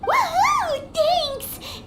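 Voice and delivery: excited cheer. Real, not acted. Paimon gets her food portion.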